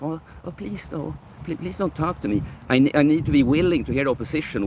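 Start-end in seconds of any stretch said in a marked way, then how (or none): 0.0-2.4 high, soft, whimpering mock plea; mock pleading. Mocking a timid streamer begging a critic to stop. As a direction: play the scared victim, begging to be spared. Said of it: "I don't need to be like".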